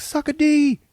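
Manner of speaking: very high, loud gleeful jeer; taunting mock shout. Brother running away with the chips, taunting shout. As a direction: call it back like a cheeky escape.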